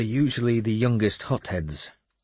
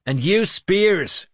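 loud, high, drawn-out order; battle shout; 11 semitones higher, 5 dB louder, slower than usual. A narrator's reading. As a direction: shout it slowly down the ranks. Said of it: "he shouted".

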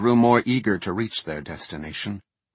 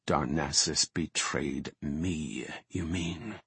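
slow, low and soft retort; low, menacing correction; glaring retort; 4 semitones lower, 8 dB quieter, slower than usual. A narrator's reading. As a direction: turn the accusation back, quietly and slowly. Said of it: "Maiev glared".

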